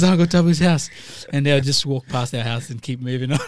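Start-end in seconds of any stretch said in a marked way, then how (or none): 0.0-0.9 put-on loud, high kid voices warning each other; mock street kids backing off; kids' warning. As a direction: kids steering their gang away from the house, loudly. Repeat of kids' line.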